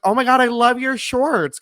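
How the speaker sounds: high, loud imitated gush; retold gushing compliment. Stacy complimenting his shorts, imitated high gushing. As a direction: imitate a fan squealing over an outfit. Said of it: "she was like".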